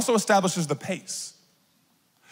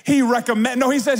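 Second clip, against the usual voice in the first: ordinary delivery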